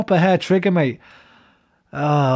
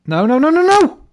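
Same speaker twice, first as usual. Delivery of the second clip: rising, loud no-no-no as the play goes wrong; frustrated shout; 13 semitones higher, 7 dB louder than usual. Real, not acted. Gamer yelling at the joypad in frustration. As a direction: yell it out at the controller.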